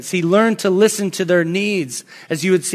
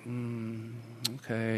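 tiny low murmured agreement; hushed, hesitant acceptance; softening; 8 semitones lower, 19 dB quieter, much slower than usual. Retold or imitated. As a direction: imitate a child grudgingly giving in, very quietly. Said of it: "He just started to soften a little".

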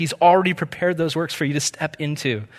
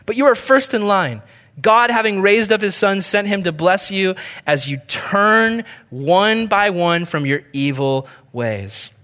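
scripture reading; 3 semitones higher than usual. Acted. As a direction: read the passage out with preacher's lift. Reads scripture aloud.

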